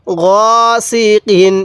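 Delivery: গসিকিন read very slowly, syllable by syllable; slow drawn-out reading. Shown as a demonstration. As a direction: stretch out গসিকিন with the long vowel held. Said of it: "কিভাবে পড়ব".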